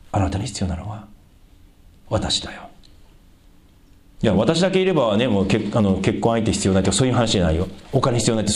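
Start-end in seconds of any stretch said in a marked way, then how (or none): 0.1-2.6 soft, low reply; gentle divine answer. Acted. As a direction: answer the desperate plea quietly, voice dropped low. God's acted answer to the plea.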